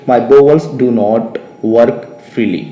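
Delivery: very slow, deliberate English for learners; teacher's careful word-by-word reading; slow model sentence. Shown as a demonstration. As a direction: stretch out each word as if dictating. Teacher models the answer slowly.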